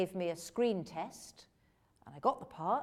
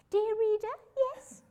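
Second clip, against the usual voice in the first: very high, loud storybook narration; high narrator voice; 8 dB louder than usual. Retold or imitated. Mocking own Two Ronnies narration, high-pitched. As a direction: mimic old narration in a piping pitch. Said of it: "I can't bear to listen to it now".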